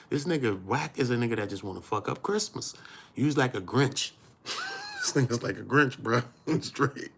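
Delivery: ordinary talk, nothing marked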